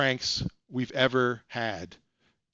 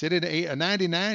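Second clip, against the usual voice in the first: prank caller voice; 4 semitones higher, faster than usual. Retold or imitated.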